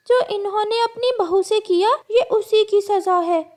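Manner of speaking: high-pitched bird passing judgement; condemning bird. Acted. Forest bird says the crows were punished for their sins, condemning.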